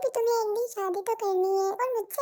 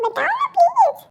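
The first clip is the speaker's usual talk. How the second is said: whiny plea; 8 semitones higher, 5 dB louder, slower than usual. Acted. Boy nagging friend to explain.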